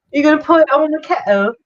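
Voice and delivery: high, plummy British toff voice; mock posh British accent. Mocking a British mate's accent on a stream, high posh English. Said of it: "That's my accent for you guys".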